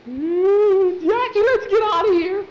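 very high, loud panicked squeal; high-pitched scared yelp; frightened squeal. Acted. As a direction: shriek it like a terrified kid. Scared boy cries out in the dark.

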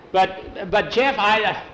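storyteller voicing a protesting boy; stammering protest. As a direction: try to get a word in, stumbling.

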